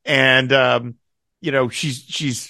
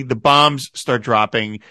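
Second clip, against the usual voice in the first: usual speaking voice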